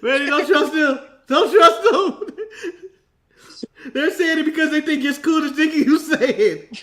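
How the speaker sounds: high, loud plea through laughter; laughing warning. Real, not acted. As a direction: warn them while cracking up. Teasing warning while laughing.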